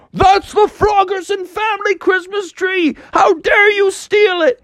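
high indignant shout; father frog outraged, very high shout. Acted. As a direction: yell at the thief.

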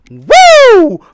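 high loud whoop; hype shout. Real, not acted. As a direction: whoop to fire up the crowd. Hype podcast opening shout.

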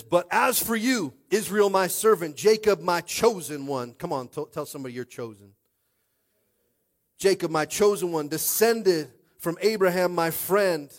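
loud, slow, rising scripture proclamation; forceful scripture reading. Acted.

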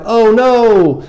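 very high, loud wail of dread; hammed-up panicked cry; full-blown freak-out. Preacher acts Gideon dreading death, loud freaked-out wail. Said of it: "full-blown fear, freak out mode".

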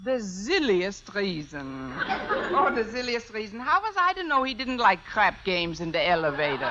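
put-on comic German accent, chuckling; a tipsy German teacher. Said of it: "If I can do a decent German accent".

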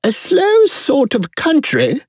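very high storybook queen voice; haughty Queen. A narrator's reading.

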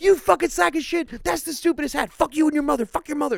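high, loud mock-furious ranting; hammed-up caller screaming insults; angry caller. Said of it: "they'd be like".

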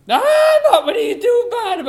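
loud exclaim. Acted. Mother character exclaiming loudly.

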